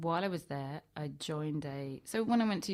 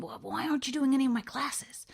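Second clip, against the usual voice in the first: high, louder mock indignant tutor; imitating the tutor; 9 semitones higher, 6 dB louder than usual. Tutor demanding why she skips his classes, mock high indignation. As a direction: play the baffled tutor, pitched up. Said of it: "And he went".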